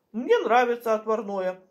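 ordinary talk, nothing marked

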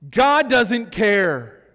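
ordinary talk

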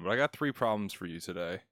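ordinary delivery

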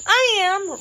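put-on high-pitched girl's answer; young Dorothy. A narrator's reading. Dorothy answers the ruler, high girlish voice.

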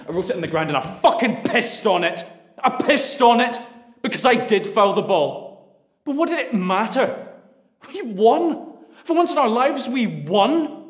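enraged son yelling at his father; furious yelling. Acted. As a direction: scream your shame and pride at him.

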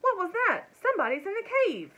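high, loud, alarmed outburst; startled alarm. A narrator's reading. Men woken by cries in the cave, alarmed. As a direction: react in sudden alarm.

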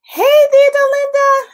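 high, loud sing-song hello; bright greeting. Real, not acted. Welcoming a viewer into the live stream, high and sing-song. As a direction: call out a happy welcome.

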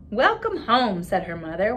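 put-on expressive read-aloud for children; story narration. A narrator's reading. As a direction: read the picture book to the class with voices. Reads picture book aloud to class.

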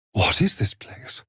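hushed whisper. Acted. Companion whispering to the party in a strange place, hushed. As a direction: whisper it, wary of the strange room.

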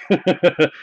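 quick chuckle; short rolling burst of laughter. Real, not acted. Laughing at the dead case half, chuckling. Laughing at his own news.

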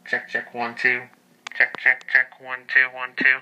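loud mic check into the PA. Shown as a demonstration. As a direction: call it out into the paging mic. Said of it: "You can hear the relays click".

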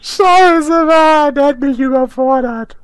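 high, wailing grumble; overwhelmed complaint. Real, not acted. Player overwhelmed by an opponent, wailing. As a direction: whine it high, totally overwhelmed.

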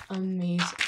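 ordinary talk

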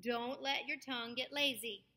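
normal talking voice

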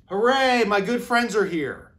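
loud high hooray of delight; excited cheer. Acted. Sick zookeeper thrilled his animal friends came to visit, joyful cheer. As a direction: cheer as if your best friends just walked in.

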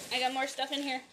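ordinary talk

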